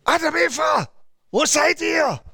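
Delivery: high, shouted angry call; furious divine shout; angry God calling. Retold or imitated. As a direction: roar it out like a wrathful God. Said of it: "und er ist zornig".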